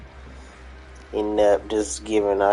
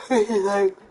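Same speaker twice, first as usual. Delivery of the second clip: yawning; 11 semitones higher, 7 dB louder, slower than usual. Real, not acted. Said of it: "my sixth yawn".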